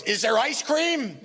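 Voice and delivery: very high, drawn-out mock call; George Shapiro. Manager interrupting to ask for ice cream, hammed-up high call. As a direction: imitate calling out, stretching the words. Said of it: "George Shapiro goes".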